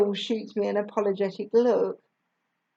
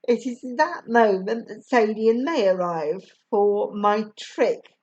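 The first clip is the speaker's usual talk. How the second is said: ordinary delivery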